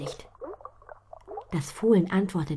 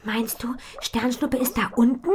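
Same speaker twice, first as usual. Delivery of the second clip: worried question; 8 semitones higher, 5 dB louder than usual. A narrator's reading. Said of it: "Sie schaut Butterblume besorgt an".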